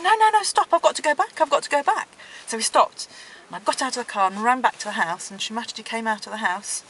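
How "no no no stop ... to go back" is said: high, loud urgent cry to stop; urgent plea. Retold or imitated. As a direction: call out in a rush to turn back. Begging husband to stop car.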